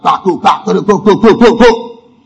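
popping mouth-made firework bursts; imitated fireworks. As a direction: fire off vocal firework bangs. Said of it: "start doing firework".

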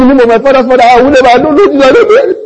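loud, high-pitched frantic oath; mock swearing. Peter swearing he doesn't know Jesus, mock desperate oath. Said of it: "He was swearing".

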